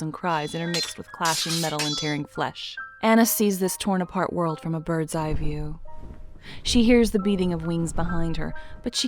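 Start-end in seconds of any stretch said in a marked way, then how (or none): none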